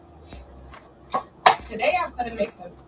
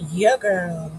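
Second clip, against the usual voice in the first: ordinary talk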